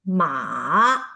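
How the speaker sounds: "ma" dipping low, then rising again; third tone. Shown as a demonstration. Teacher demonstrates dipping third tone to students. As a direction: let "ma" dip down and come back up. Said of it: "goes down and then rise again".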